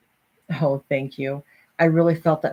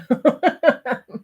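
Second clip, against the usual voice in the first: higher-pitched burst of laughter; 6 semitones higher than usual. Real, not acted. Speaker admitting it doesn't always work, laughing. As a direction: laugh it off after an admission.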